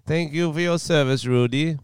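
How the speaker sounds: solemn mock Borat praise; lightly voiced Borat. Borat replies to Giuliani.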